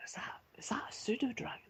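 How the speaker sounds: high, hushed whisper. Acted. Guard to guard about the pseudo dragon, whispered. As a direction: whisper it in awe. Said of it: "one of the guards starts to whisper".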